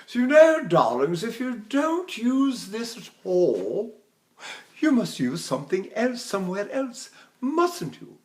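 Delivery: grand English lady voice teacher; lilting, theatrical English accent. Acted. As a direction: play an English dame correcting her darlings. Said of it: "English accent".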